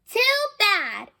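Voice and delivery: high, sharp little "Too bad"; defiant retort. Little sister snapping at her brother, defiant high retort. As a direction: toss it back at him with a glare. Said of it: "she told him with her glare".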